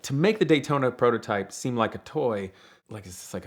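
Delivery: normal talking voice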